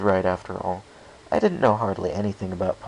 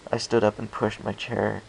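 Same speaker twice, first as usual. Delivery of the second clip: plain reading voice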